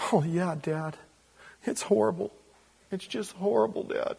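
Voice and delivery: high, put-on pity for Dad; hammed-up mock grief from the sons; fake sympathy. Sons fake comfort to their father in high mock grief. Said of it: "They go".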